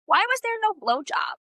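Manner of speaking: high-pitched, indignant complaint; mock outrage. Real, not acted. Disappointed reader complains to the co-host about missing spice, mock outrage.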